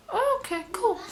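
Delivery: very high, soft little reply; retold feigned okayness. To friend after bad news, feigned okay in high voice. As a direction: imitate taking it lightly, voice jumping up high and quiet.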